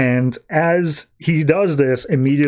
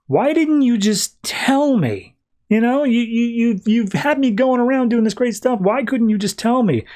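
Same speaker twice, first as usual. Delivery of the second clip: exasperated complaint; 9 semitones higher than usual. Retold or imitated. Kyle confronting Guardians who kept him hidden, exasperated. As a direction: protest it, voice pitched way up.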